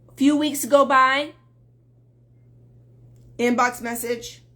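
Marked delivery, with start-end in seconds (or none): none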